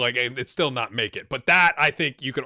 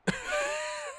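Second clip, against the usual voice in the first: high laugh; 7 dB quieter than usual. Real, not acted. Laughing at Paul.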